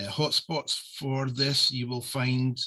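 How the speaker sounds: normal talking voice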